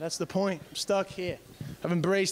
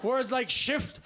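ordinary delivery

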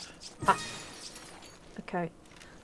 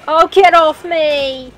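being chased; 6 semitones higher, 9 dB louder, slower than usual. Real, not acted. Monster attacking player in game.

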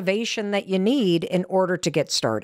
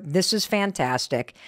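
normal talking voice

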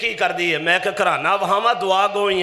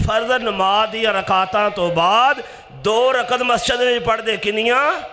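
raised, emphatic pulpit delivery; ringing preacher's voice, pitched up; full-force preaching; 4 semitones higher, 4 dB louder than usual. Real, not acted. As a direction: quote it forcefully, then quiz the congregation.

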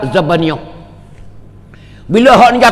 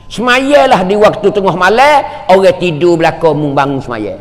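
usual speaking voice

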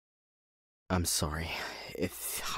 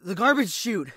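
blurted out higher and louder; sudden idea; 9 semitones higher, 7 dB louder than usual. Acted. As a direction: exclaim like the answer just hit you.

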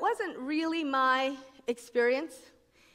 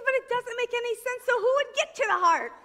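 retorting co-writer, laughing; 9 semitones higher than usual. Retold or imitated. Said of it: "And she said".

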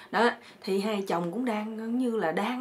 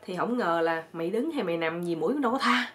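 usual speaking voice

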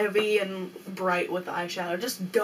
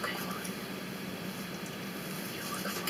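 whispering; 14 dB quieter, faster than usual. Real, not acted. Whispering off camera before intro.

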